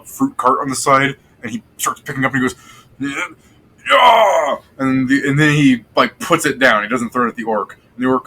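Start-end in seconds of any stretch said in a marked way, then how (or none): 3.9-4.6 loud, high macho yell; hyped shout. Acted. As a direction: bellow it like a showing-off strongman. Dwarf NPC taunting at fruit cart.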